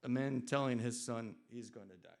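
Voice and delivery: plain reading voice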